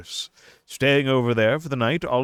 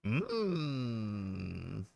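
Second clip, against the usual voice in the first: ordinary talk, nothing marked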